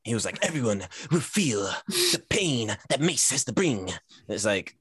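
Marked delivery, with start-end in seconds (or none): 0.5-3.9 slow, dramatic anime villain delivery; anime character voice. Retold or imitated. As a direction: intone it like a brooding anime character. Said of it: "Talks like an anime character".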